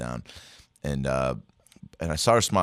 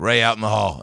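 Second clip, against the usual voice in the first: imitating teacher's order; 4 semitones higher, 7 dB louder than usual.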